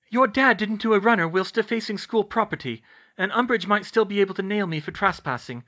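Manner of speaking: insistent high-voiced objection; worried young witch. A narrator's reading. Hermione argues.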